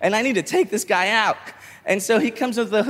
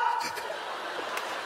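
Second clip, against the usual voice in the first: crazed laugh; 13 dB quieter than usual. Retold or imitated.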